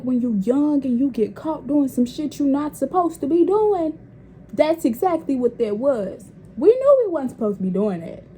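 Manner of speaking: ordinary talk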